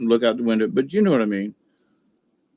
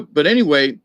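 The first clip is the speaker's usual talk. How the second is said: ordinary talk, nothing marked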